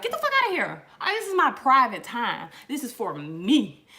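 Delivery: hammed-up bathroom rant; yelling at the cat.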